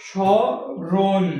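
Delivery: স্মরণ drawn out slowly as "sho-ron"; slow dictation of স্মরণ with silent ম; correct pronunciation. Shown as a demonstration. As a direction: say স্মরণ syllable by syllable, no m. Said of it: "আসল উচ্চারণটা আমরা লিখি".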